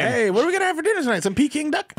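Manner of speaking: put-on high, quick kid voice; squeaky mock duckling; cartoon duckling. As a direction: ask it high and fast like an animated child. Voices ducklings asking for dinner.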